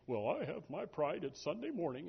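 lightly voiced prideful churchgoer; a hint of a smug Sunday saint; mildly snooty churchgoer quip; mock proud churchgoer.

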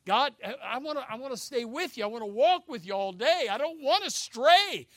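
loud, rising cry to God; impassioned preacher's prayer; determined plea. Acted. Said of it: "we have got to be determined".

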